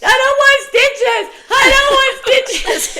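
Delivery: very high, loud mock wail; imitated yelling. Mother imitating son refusing stitches at the sink, yelling. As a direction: yell it like a protesting kid. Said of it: "he's yelling".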